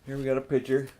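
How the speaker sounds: ordinary delivery, nothing marked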